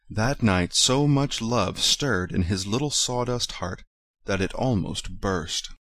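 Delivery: plain reading voice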